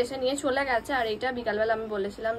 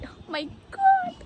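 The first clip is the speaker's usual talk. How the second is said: mock dramatic exclamation; much slower than usual. Mocks her own English habit.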